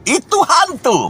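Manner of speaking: high, slow shriek of fright; terrified cry. A narrator's reading. Disciples seeing a figure on water cry out in terror. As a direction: scream it like a disciple seeing a ghost. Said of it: "terkejutlah berseru".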